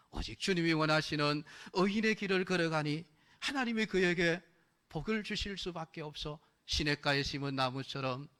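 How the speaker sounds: usual speaking voice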